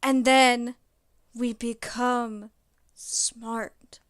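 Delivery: loud, drawn-out dumb frat bro; slow hyped dude-bro pitch; frat boy voice. Pretend. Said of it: "You gotta do it in the frat boy voice".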